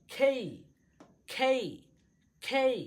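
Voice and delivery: "খেই" loud and high, with a puff on kh; aspirated K. Shown as a demonstration. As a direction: drill "খেই", strong kh gliding to ay-ee. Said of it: "কি বলবো?". ("খেই" জোরে, বাতাস দিয়ে)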